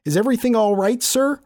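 high, concerned attendant's question; polite attendant. A narrator's reading. Scene: attendant checks on the shaken narrator, high and concerned. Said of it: "he asked".

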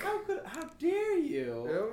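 mock indignation. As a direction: ham up outrage, dragging it out. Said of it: "making fun of the way I talk".

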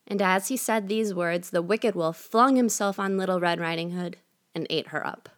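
ordinary delivery, nothing marked

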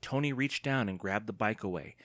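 ordinary delivery, nothing marked

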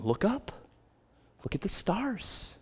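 a quiet, distant divine call; God speaking gently. Acted. God speaking softly to Abram.

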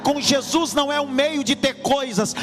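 rising preacher's proclamation building to a high shout; full-force preaching. Acted. (pregação inflamada que cresce até o grito)

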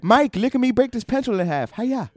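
high, excited dad impression; cartoonish high-pitched dad voice, a karate shout at the end; friend's dad impression.

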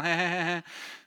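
high, staccato put-on snicker; mock sneaky laugh. Sisters plotting behind brother's back, mock sneaky laugh. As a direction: fake a scheming giggle.

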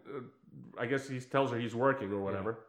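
ordinary talk

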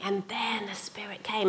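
hushed, awed whisper. A narrator's reading. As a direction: breathe it like a secret. Dramatic climax of Pentecost story.

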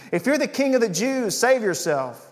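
high mocking taunt, called up. A narrator's reading. Soldiers taunting the crucified king, high and mocking. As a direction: jeer it up at him. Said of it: "They called out to him".

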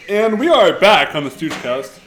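loud podcast host reopening; announcer voice. Acted. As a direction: announce the show's return. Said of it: "show reopening".

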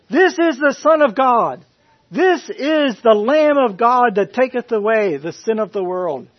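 loud, high proclamation; confident declaration. Retold or imitated. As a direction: preach it with full certainty. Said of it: "with full confidence".

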